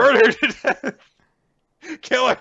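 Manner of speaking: high, loud, gleeful command; villain's order. Acted. Orochi commanding his men to burn Hiyori, high gleeful. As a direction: bark orders like a gloating tyrant.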